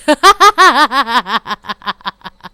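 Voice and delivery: loud laughter. Real, not acted. Laughing at the scene.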